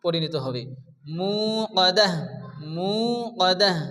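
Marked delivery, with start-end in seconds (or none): 1.2-3.8 মুকাদ্দাহ ending in a breathed h; long mu, stop মুকাদ্দাহ on an audible h; final ta as ha. Shown as a demonstration. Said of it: "এই তাটা হা সাকিনে".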